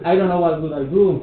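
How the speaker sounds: exaggerated intonation. Shown as a demonstration. Student repeats after teacher, loud exaggerated intonation. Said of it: "Excellent, like that".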